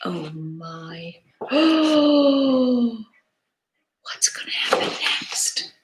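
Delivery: soft unvoiced suspense; whisper. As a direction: hush them with a whisper. Suspense for listening children.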